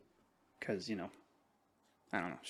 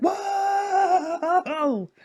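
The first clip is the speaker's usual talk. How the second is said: loud, high amazed whoa; excited whoa; 5 dB louder than usual. Retold or imitated. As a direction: shout it in amazement. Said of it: "I was like".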